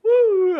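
very loud, high woo; excited whoop. Real, not acted. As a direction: whoop out loud after laughing. Whooping after laughing hard.

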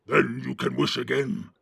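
loud growled orc retort; forceful orc growl. A narrator's reading. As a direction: growl back as the orc boss. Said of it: "said the growling voice".

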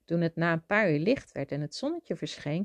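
ordinary delivery, nothing marked

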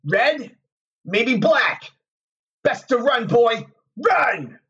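sardonic warning. A narrator's reading. Tom's apparition warning Rand to run, sardonic. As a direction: goad him with warnings, rising to an urgent cry. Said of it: "Tom grinned at him sardonically".

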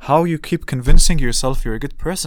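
loud, incredulous rant; exasperated outburst. Real, not acted. Venting about the uncle, loud and exasperated. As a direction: let the frustration spill over.

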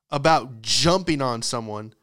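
ordinary delivery

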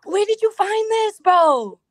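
excited. Real, not acted. Excited asking about the video.

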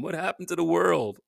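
usual speaking voice

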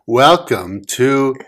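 slow drawn-out announcer welcome. Acted. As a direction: stretch it like a host. Announcer-style show welcome.

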